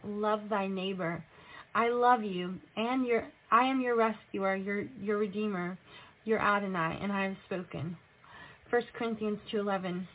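normal talking voice

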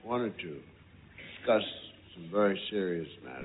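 deep Cosby impression. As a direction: drop the voice deep and grave.

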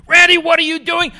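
furious, high-pitched shouted impression; screaming. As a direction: scream at him in exasperation. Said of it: "he starts screaming at me".

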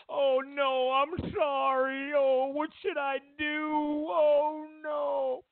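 high, slow, whiny fake panic; mock panicked apology. Celebrity caught out, hammed-up high whiny panic. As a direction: ham up a frightened celebrity begging.

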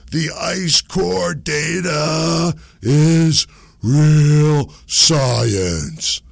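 high, loud, very slow mock shout; hammed-up outraged science believer; unloading zealot. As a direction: bellow each word, imitating a man unloading. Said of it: "This dude just unloads".

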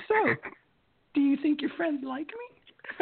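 put-on high, slow cutesy girl voice; coy sweet girlfriend imitation. Girlfriend's question voiced in story.

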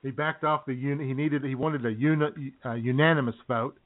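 ordinary delivery

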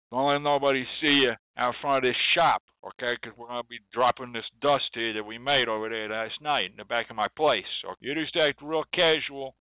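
put-on casual, run-together city street accent; city hoodoo worker's accent. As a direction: talk like a streetwise insider in a quick city accent. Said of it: "But if somebody else said to you".

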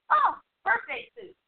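high, bright blurted guess; eager wrong guess. Retold or imitated. Coworkers watch her blurt the wrong guess, high and eager. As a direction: shout out the wrong answer, sure of it.

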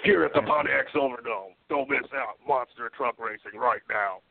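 booming hype announcer; monster truck ad. Pretend. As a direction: shout it like a rally ad. Monster truck rally ad parody.

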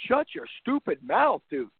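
slow, high, mock-angry retort; mock telling off. Fan answering a whining quarterback, mock anger. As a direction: bark it back at a whining player. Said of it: "you would say".

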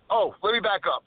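ordinary talk

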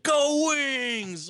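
high, loud mock cheer of a fan. Fan yelling during a moment of silence, high mock cheer. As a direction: yell it like a clueless fan cheering. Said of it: "some idiot Detroit fans like".

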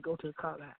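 a quiet inner nudge; soft voice of God. Retold or imitated. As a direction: hush it like a still small voice. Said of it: "that little soft voice said".

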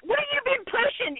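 incredulous. Real, not acted. Co-host mocks Bob's letter.

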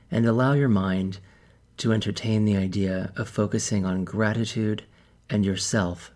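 calm, measured meditation guide with long pauses; softened, even delivery, leaving space between instructions; guided meditation. Real, not acted. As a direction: ease listeners into stillness, phrase by phrase.

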